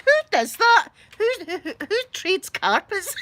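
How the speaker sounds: high, loud disbelief; incredulous. Retold or imitated. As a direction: exclaim it in baffled mock outrage. Her incredulous reply to mum retold.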